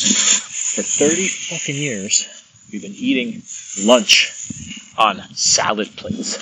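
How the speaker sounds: a fed-up husband finally snapping over the small plates; irritated husband's rant. Retold or imitated. Husband after a bad day complains to wife, mock-angry and slow.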